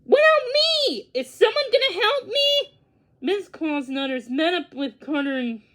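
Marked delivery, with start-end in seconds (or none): 0.1-2.8 whiny plea. A narrator's reading. Abandoned sheriff pleading.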